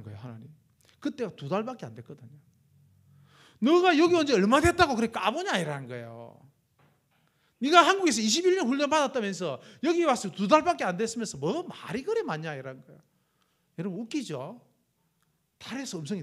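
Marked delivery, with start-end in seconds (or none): 3.6-12.5 louder, higher scolding from above. Retold or imitated. God's voice from the moon scolding the boastful missionary, louder rebuke. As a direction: rebuke him like a stern elder.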